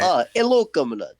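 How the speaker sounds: mock Russian greeting; hammed-up Russian comrade; Russian accent. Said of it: "the Russian accent".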